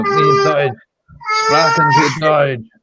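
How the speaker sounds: loud, high mock German accent; aggressive German. Shown as a demonstration. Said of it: "Really aggressive".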